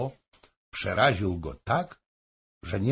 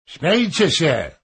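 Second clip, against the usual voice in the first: higher and louder defiant call; 8 semitones higher, 8 dB louder than usual. A narrator's reading. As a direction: shout them down. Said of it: "zawołał". (głośne, wyzywające zawołanie)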